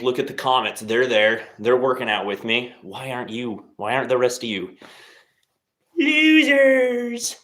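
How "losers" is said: a shrill, much higher mock jeer, louder; rage bait taunt. Taunting his viewers to rile them: mocking high jeer. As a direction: toss the insult out to bait them. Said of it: "Just gonna rage bait the other".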